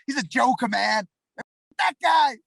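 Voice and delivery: high, loud mock American accent. Fighter acting out a guy who badmouths opponents, loud American accent. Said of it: "I don't know why I'm talking American there".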